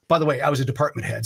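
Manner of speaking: ordinary delivery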